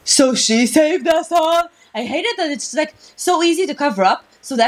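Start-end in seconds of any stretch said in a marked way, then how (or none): 0.0-1.7 slow, high clueless voice; dumb FBI agent. Retold or imitated. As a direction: play a dim-witted agent. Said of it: "just be like".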